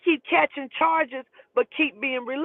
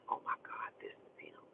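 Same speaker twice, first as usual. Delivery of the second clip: imitated whisper, barely any voice; whispered alarm; 22 dB quieter than usual. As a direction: whisper it as if he could hear. She reacts as phone rings.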